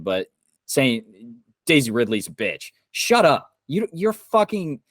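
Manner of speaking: real exasperation boiling over; angry outburst. Real, not acted. Angry rant at online trolls.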